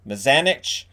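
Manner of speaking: "Mazanec" ending in a "ch" sound; alternative pronunciation. Shown as a demonstration. Host trying out a goalie's name, alternative pronunciation. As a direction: say "Mazanec" as muh-ZAN-itch, rising. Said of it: "different ways to pronounce it".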